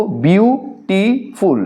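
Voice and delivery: "বিউটিফুল" slowly in three parts, bew - ti - ful; split into syllables. Shown as a demonstration. Teacher points pupils to the divisions, "বিউটিফুল" split bew, ti, ful. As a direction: pause between each syllable of "বিউটিফুল". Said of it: "আমরা এটাকে এই যে ভাগটা দেখো".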